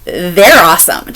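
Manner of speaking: ordinary talk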